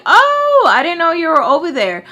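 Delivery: high, loud fake-startled gasp of a line; mock surprise. Startled by the boyfriend's daughter, high and loud mock surprise. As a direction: squeal it like you just got caught off guard. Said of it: "I'm like".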